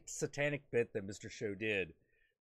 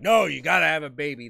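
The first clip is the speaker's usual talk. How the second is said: fast, much louder insistence; mock cult leader insisting on babies; bossy demand; 3 semitones higher, 12 dB louder, much faster than usual. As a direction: bark the order at them. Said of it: "if you're like".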